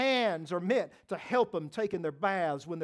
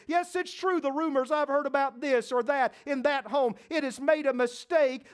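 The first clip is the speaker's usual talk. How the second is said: higher, louder declaring voice; resolute believer; 6 semitones higher, 4 dB louder than usual. Retold or imitated. Preacher voicing a believer's resolve to trust God, rising declaration. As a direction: declare it like a vow. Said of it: "you've got to say".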